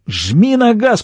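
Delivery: high-pitched, urgent command; excited command with contained volume; urgent order. A narrator's reading. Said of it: "приказал Дуг".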